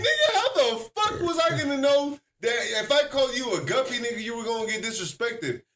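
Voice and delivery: exasperated protest. Retold or imitated. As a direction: argue back in disbelief. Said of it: "I was like".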